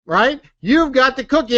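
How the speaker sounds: ordinary talk, nothing marked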